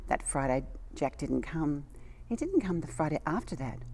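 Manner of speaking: put-on gentle read-aloud storytelling for children; storybook reading. A narrator's reading. Reading a story to little children, storybook voice. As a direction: tell it like a bedtime picture book. Reads a picture book aloud.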